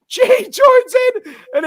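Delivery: high, loud, drawn-out announcement; loud squeal of excitement; excited storytelling. Real, not acted. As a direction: hit it high and loud for the big moment. Excitedly retelling the fight's start.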